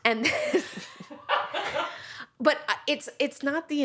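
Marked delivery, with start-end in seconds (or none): 0.7-2.3 airy high giggle; breathy laugh. Real, not acted. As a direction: let out a soft laugh. Laughs at her own story.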